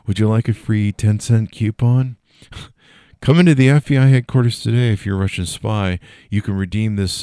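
loud, hammed-up ad pitch; loud joking advert read; mock ad announcer. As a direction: sell the spy coupon like a cheesy commercial. Mock FBI ad for Russian spies.

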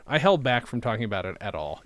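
normal talking voice

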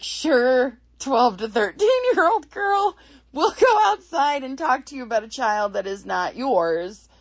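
loud, high-pitched mock agreement; mock-agreeable doctor. Doctor agreeing to a teenager's request, mockingly loud. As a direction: play an implausibly obliging doctor, loudly. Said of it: "a doctor would be like".

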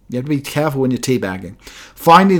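ordinary talk, nothing marked

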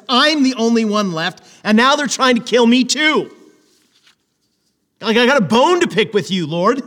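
aggrieved complaint. Acted. Elijah complaining loudly to the Lord, aggrieved. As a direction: pour out the grievance, building heat.

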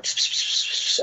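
soft unvoiced pss pss of engineers whispering; imitated whispering. Engineers whispering in the booth, soft pss sounds. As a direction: hiss it like people whispering behind glass. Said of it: "like, going".

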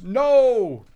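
sudden loud "No!" cutting in; shouted no. Retold or imitated. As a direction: shut it down with a loud "No!". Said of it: "the other brother goes".

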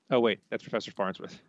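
normal talking voice

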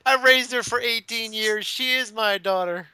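high, slow, hammed-up plea; mock daytime TV drama. Friend playing a Maury guest, hammed-up pleading. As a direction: plead like a talk-show guest.

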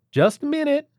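high, polite call from inside; polite call through door. Acted. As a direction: call out politely, still friendly. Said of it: "hollered again, this time less polite".

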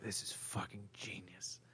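hushed awed whisper. Retold or imitated. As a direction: breathe it out, amazed. Whispered reaction retold.

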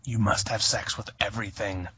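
put-on temptress voice. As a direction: ham up a wild-child adviser. Voicing the character's advice.

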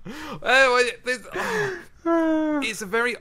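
high, loud laughing stammer. Real, not acted. Host cracking up while trying to restart the sonnet talk, laughing.

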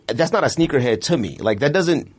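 ordinary talk, nothing marked

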